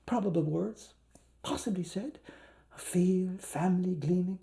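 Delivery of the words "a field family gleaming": softer, breathed phrase; whisper. Acted. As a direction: drop to a whisper. Hushed phrase in reading.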